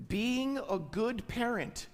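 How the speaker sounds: "Being a good parent" drawn out, slow and deliberate; slow it down for the congregation to catch. Shown as a demonstration. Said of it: "I'll say that again slower".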